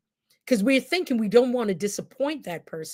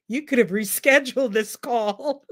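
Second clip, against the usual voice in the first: laughing quote; 2 semitones higher than usual. Retold or imitated. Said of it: "That's why I'm laughing at that".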